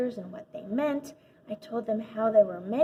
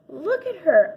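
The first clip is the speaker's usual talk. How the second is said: louder, high urging call; 7 semitones higher, 6 dB louder than usual. A narrator's reading. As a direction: push the class to look. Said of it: "I urged the rest of the class".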